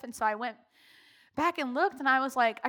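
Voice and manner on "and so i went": ordinary talk, nothing marked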